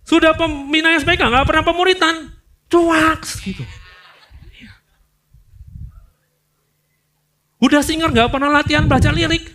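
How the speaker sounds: high, loud scolding; preacher's loud scolding; loud rebuke. Real, not acted. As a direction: hammer it at the lazy servants. Preacher rebuking lazy servants.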